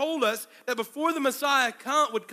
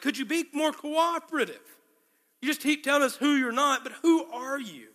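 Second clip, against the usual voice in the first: higher-pitched, exasperated demand; acted exasperation, pitched up; frustrated questioners; 5 semitones higher than usual. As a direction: press the witness, acting out mounting frustration. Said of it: "they're getting frustrated".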